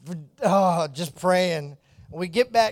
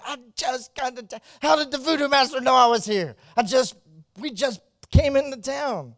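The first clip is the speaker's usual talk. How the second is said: bewildered; 9 semitones higher, 5 dB louder than usual. Retold or imitated. Bewildered reaction to summons.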